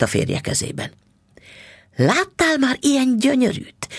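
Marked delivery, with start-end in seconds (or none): 2.0-3.9 proud husband crying out, pitched high; husband shows off the fur with a high, excited cry; excited exclaim. A narrator's reading. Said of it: "kiáltott mister Bixby".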